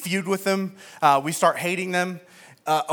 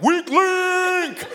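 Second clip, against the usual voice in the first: high, loud mocking jeer; taunting yell; 11 semitones higher, 3 dB louder than usual. Husband heckling his wife on her turn, high jeering yell. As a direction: razz her as the weak link.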